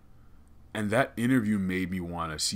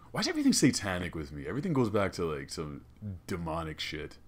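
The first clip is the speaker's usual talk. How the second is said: ordinary talk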